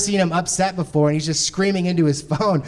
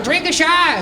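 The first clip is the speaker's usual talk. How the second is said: a pitched-up stranger's voice urging him to drink; disguised voice; 8 semitones higher than usual. Pretend. Comic telling how he urged his friend to drink, in a disguised higher voice. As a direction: pretend to be someone else in the crowd, higher. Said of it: "changing my voice".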